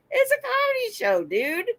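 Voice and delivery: high, exasperated protest. Retold or imitated. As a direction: toss it off like it's obvious. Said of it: "But I'm like".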